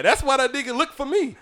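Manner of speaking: ordinary talk, nothing marked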